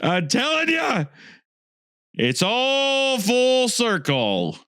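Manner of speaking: high, slow shouted triumph; excited proclamation. Real, not acted. Host declares the conspiracy solved, slow high shout.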